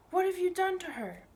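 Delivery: high, loud frightened demand; boy horrified at the news; alarmed. Acted. Boy confronting uncle in alarm.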